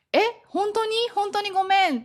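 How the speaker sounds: high-pitched startled apology, acted; taken aback. Japanese listener told of a two-hour wait, acted surprise. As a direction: act shocked and sorry, pitch jumping up. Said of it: "ちょっと突っ込まれるかもしれない".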